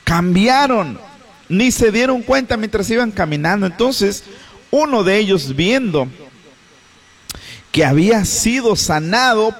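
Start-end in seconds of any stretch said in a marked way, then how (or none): none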